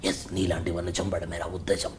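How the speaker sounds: low, hushed film-father voice, swelling on the count; hushed, whispery rebuke that builds as the list grows; Kamal Haasan impression. Father answering his son about bribes, low whispery impression. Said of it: "ఇదే కమల్ హాసన్ గారికి వచ్చేసరికి".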